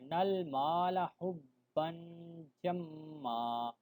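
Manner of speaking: نل مال حبا جما chanted slowly after stepping back one word; slow recitation picking up from نل مال; restart one word back. Shown as a demonstration. Teacher showing a restart after losing breath, slow chant. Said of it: "ایک لفظ آپ نے پیچھے جانا ہے ایسے".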